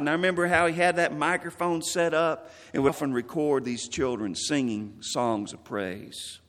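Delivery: ordinary talk, nothing marked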